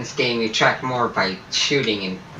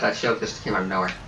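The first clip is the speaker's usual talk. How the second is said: normal talking voice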